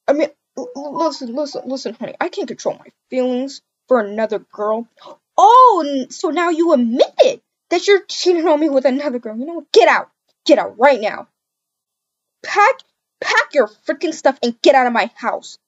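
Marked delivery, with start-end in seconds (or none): 5.4-15.6 loud furious yelling, kicking him out; betrayed girlfriend shouting at boyfriend; angry shout. Acted. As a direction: scream at him to get out.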